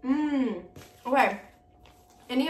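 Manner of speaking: talking with food in mouth; eating chocolate while talking; chewing. Real, not acted. Seen 4: chocolate bar in hand, raised to mouth, face mid-chew early.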